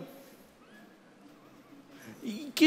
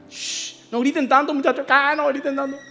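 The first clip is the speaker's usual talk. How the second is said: hushing the crew; 5 semitones higher than usual. Acted. Disciple hushing crew near sleeping Jesus.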